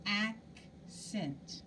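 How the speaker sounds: "Accent" with AC stretched out; longer first syllable. Shown as a demonstration. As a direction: hold the AC of "Accent", pause, then cent. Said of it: "tell me if I said it longer".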